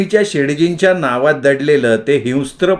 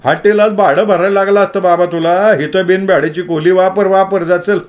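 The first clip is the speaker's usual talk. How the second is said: sarcastic policeman; 5 semitones higher than usual. A narrator's reading. Policeman sends Somu to cell.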